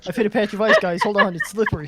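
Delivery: loud call to the party, sliding on ice. Pretend. Warning the party while slipping on ice, calling out. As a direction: shout a warning to the group.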